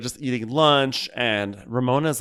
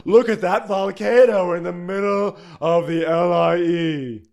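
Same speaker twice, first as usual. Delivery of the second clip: high, louder hammed-up diva; mock Luann; 7 semitones higher, 5 dB louder than usual. Host imitating Luann on the highway, hammed-up diva. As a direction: gush like a dazzled celebrity.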